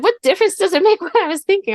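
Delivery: high baffled protest with a laugh; high incredulous retort; indignant mom. Retold or imitated. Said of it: "I said".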